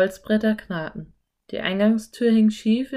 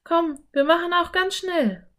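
put-on higher, bright little girl suggesting; girl voice, keen to go in; eager Anne; 10 semitones higher than usual. A narrator's reading. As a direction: voice the curious girl, pitched up. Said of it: "fragte Anne".

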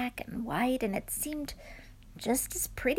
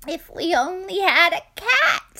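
put-on wistful sigh, louder old woman; 7 semitones higher, 8 dB louder than usual. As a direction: sigh it out longingly. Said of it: "sighed the very old woman".

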